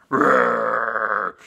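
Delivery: loud roar of a bear being hit; storybook bear roaring under blows. Acted. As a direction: roar back as the shepherd strikes. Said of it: "And the bear went".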